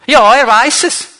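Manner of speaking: evasive answer. Retold or imitated. Couple answers the youth leader.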